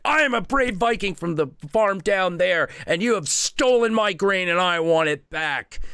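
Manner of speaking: put-on high, puffed-up boyish declaration; brave little boy. Little Viking boy confronting the North Wind, boastful. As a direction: stand tall and demand it like a tiny hero. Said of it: "and he's like".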